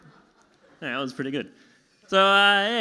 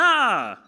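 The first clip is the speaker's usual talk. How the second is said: imagined martial-arts attack yell; battle cry; 5 semitones higher, 5 dB louder than usual. Acted. Comic imagining himself fighting off an attacker, battle cry. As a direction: let out a fighting "ah".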